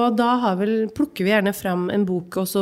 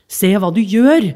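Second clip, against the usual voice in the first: angry scolding; 8 dB louder, slower than usual. Acted. Man squeezes child, scolds.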